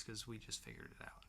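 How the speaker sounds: hushed joking confession; whispered aside. Real, not acted. Host telling viewers they just figured it out, whispering. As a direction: whisper it like a secret. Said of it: "joking aside to viewers".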